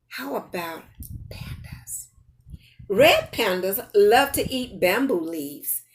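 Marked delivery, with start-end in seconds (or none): none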